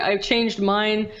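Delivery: ordinary delivery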